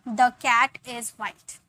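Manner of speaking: slow model sentence for learners; slow spelled-out sentence. Shown as a demonstration. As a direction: dictate it slowly to the class. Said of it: "मैं बोलूँगी".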